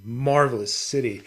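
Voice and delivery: ordinary talk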